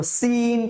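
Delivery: "সিন" with a thin, hissed s and long ee. Shown as a demonstration. Teacher reviewing the letter, thin "সিন". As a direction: keep the s of "সিন" narrow like leaking air. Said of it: "পাতলা করে".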